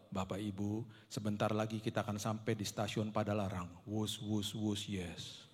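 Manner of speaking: low, listless train announcement; ogah-ogahan. Retold or imitated. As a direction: read it like someone not paid to talk. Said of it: "Kayak orang disuruh ngomong nggak dibayar". (pengumuman kereta datar dan ogah-ogahan)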